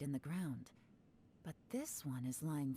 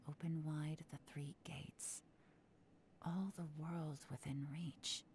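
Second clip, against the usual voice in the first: hushed, unvoiced chant of a spell; breathless whispered invocation; whispered incantation; 4 dB quieter than usual. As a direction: whisper the words like a secret rite. Whispered incantation to unlock compass.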